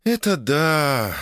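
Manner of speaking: high, long-drawn dreamy agreement; dreamy wife. A narrator's reading. Wife daydreaming about the honeymoon, dreamy drawl. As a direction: draw it out high, lost in the thought.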